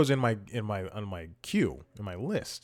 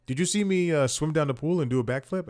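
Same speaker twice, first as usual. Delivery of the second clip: put-on a light Italian accent, higher; 6 semitones higher than usual. Host quoting the Italian guy at the pool, light Italian accent. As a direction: lightly imitate an Italian stranger. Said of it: "He has an accent".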